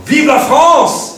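high, slow, loud patriotic cry; cheering Vive la France. Pretend. Teacher crying out the French rally cry to his class.

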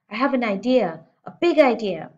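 put-on a girl bursting with a plan; rising excitement; eager. A narrator's reading. Girl with a sudden idea.

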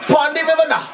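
high, loud Jamaican patois; Jamaican accent. Retold or imitated. Player belting the song in a Jamaican accent. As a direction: shout it Jamaican style again, stronger. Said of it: "I can't do the accent right".

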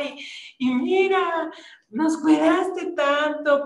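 high, slowing heartfelt plea to God; emotional prayer. Retold or imitated. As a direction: pour out gratitude to God. Retelling their emotional prayer.

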